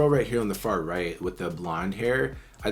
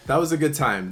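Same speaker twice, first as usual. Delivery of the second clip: laughing; 6 semitones higher than usual. Real, not acted. Laughing after fun song.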